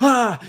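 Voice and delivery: high, loud startled "ah"; panicked yelp. Retold or imitated. Photographer panicking during the hour with gorillas, high yelp. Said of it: "you're just freaking out".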